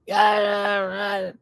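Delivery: high, drawn-out garbled holler; mock hollering from the next camper; imitated yelling. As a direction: imitate muffled family yelling in stretched cries. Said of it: "here comes the yelling going on".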